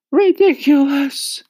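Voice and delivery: high loud slow shout. A narrator's reading. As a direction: yell it, outraged. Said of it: "they shouted".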